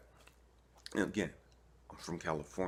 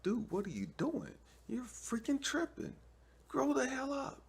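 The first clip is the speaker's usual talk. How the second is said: much higher voice for God, scolding. Acted. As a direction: play God telling him off in a high voice. God scolds him, acted.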